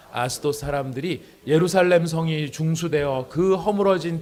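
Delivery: loud, slow, measured Bible reading; scripture reading. Acted. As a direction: read the passage out strongly for a congregation. Reads Bible passage aloud. (크고 느리게 또박또박 성경 봉독)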